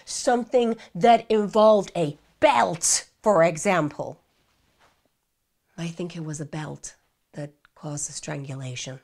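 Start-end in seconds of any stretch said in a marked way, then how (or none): 5.8-9.0 much softer, low, mechanically repeated; meek parroted answer. Retold or imitated. Suspect parroting the belt answer to police, meek and soft. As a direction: recite it back meekly.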